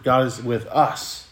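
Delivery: slow and measured, weight on final "us"; stress on US. Shown as a demonstration. Preacher repeating the phrase to emphasize its last word, "us". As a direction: draw it out and lean on the word "us". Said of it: "if I can emphasize that last word".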